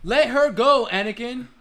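loud, high-pitched, urgent movie plea; demonstrated dramatic film line. Shown as a demonstration. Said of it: "Do you wanna know how the scene goes".

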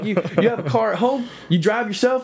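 high, mocking Southern cop voice. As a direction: cop grilling a kid on a car roof, mock Southern voice. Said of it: "fantastic racist cop vibe".

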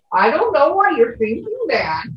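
sing-song Minnesota lilt with a bouncy up-and-down tune; a touch of Minnesota accent, lightly played; Minnesotan accent. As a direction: slip back into a homey Minnesota accent without noticing. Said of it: "I switch back into the accent".

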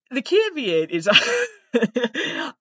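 slow, high, breaking into laughter; laughing. Real, not acted. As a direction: laugh your way through it. Laughs mid-sentence.

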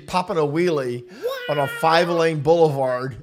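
loud, high-pitched, tickled retelling; amused disbelief. Real, not acted. Pastor relishing the wheelie story with his co-host, loud and amused.

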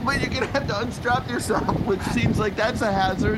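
high anxious warning; worried. Acted. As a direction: warn him in alarm. Worried objection during plunge.

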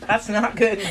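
high, loud giggly remark; laughing. Real, not acted. Tipsy host to her friend after the bad sound, laughing. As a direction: say it breaking up into laughter.

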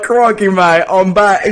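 loud, high "Crikey mate"; hammed-up slang shout; mock Aussie Jesus. Friend voices Dave Christ returning, loud mock accent. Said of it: "he's like".

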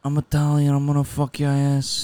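hammed-up Italian accent; Scorsese Italian accent. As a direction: put on a movie Italian-American accent. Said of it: "such a very Martin Scorsese Italian accent".

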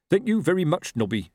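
sarcastic thanks, starting high and dropping; bitter. A narrator's reading. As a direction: thank a mate sourly. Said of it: "he said bitterly".

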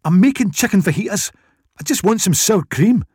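high-pitched, frustrated Scottish voice; exasperated Scottish woman. A narrator's reading. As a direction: explain in fed-up, thick Scottish accent.

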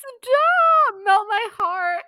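high, loud fangirl squeal; real excited gushing. Real, not acted. Host overwhelmed by a sweet book moment, excited squeal. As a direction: burst out high, melting with delight. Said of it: "I just got really excited".

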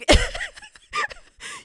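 a very high, loud burst of laughter; shrieking laugh. Real, not acted. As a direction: crack up at your own impression. Laughs at own impression.

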